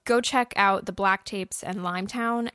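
normal talking voice

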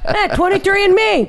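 loud New York comic shouting; New York accent. Pretend. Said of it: "Andrew Dice Clay does it".

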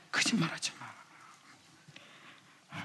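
slow breathy whispered rebuke. Shown as a demonstration. Preacher whispering the rebuke to the congregation. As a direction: lean in and whisper it slowly.

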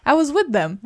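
high, laughing mock voice; mock hitman voice. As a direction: play the hitman goofy and high. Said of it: "he's just saying".

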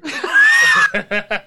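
high, loud burst of laughter; real shrieking laugh at his own story. Real, not acted. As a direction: explode into a howling laugh. Punchline of an absurd story.

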